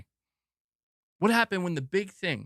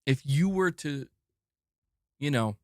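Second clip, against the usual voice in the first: usual speaking voice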